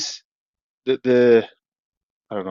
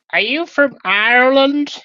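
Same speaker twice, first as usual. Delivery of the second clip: put-on much higher, slow American accent; 5 dB louder, slower than usual. Caller asking where he's from, American accent. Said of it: "imitate them ... American accent".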